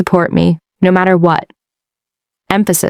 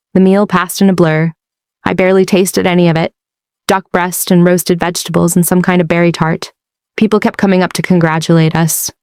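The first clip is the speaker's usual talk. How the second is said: usual speaking voice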